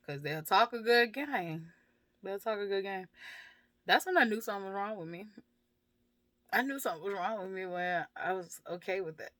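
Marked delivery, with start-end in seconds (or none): none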